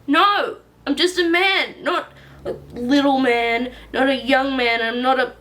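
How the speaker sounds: high, loud furious protest; angry outburst. Acted. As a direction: shout it back furious.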